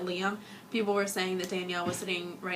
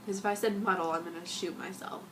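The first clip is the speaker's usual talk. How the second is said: usual speaking voice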